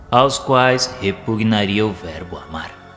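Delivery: ordinary talk, nothing marked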